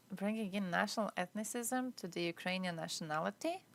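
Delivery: normal talking voice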